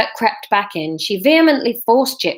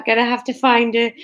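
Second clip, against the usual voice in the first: ordinary delivery, nothing marked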